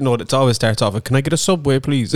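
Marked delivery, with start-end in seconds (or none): 1.0-2.1 clueless customer. Retold or imitated. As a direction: order like someone who has no idea. Mocking clueless Subway orderer.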